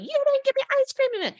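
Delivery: put-on high, loud whining kid voice; whiny child. Kids whining in the car.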